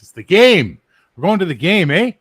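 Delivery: loud, exasperated correction of the American. Real, not acted. Canadian yelling at the American over hockey, loud and exasperated. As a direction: bark it out, fed up.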